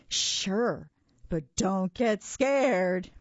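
put-on spooky tease for the nervous kid; a monster's teasing warning. A narrator's reading.

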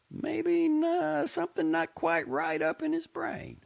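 high-pitched, folksy busybody full of theories; nosy chatty Southern lady. Acted. Lady to two young strangers on the bus, nosy chatter. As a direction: prattle on in a high Southern character voice.

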